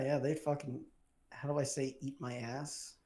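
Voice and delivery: normal talking voice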